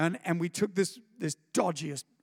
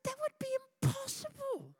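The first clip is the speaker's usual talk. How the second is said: incredulous; 7 dB quieter than usual. Real, not acted. Disbelief at sleeping in storm.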